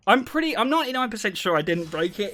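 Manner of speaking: ordinary talk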